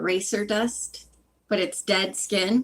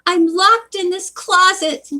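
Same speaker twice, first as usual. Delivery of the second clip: high, loud cry for help; acted, pitched-up shout; 9 semitones higher, 5 dB louder than usual. A narrator's reading. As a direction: call out through a closed door. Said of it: "she shouted".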